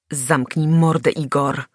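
ordinary delivery, nothing marked

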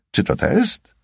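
a hint of a smarmy flirt; high mocking question. A narrator's reading. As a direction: jump way up on "test".

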